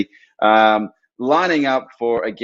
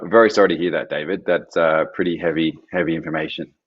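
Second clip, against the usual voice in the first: lower, subdued condolence; sincere sympathy; 5 semitones lower than usual. Real, not acted. Host consoles a bereaved viewer, sincere sympathy. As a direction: offer it gently, dropping lower.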